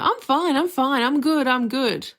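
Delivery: hammed-up forced cheer; mock I'm fine. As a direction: act cheerily fine when you're not. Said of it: "we try so hard to be like".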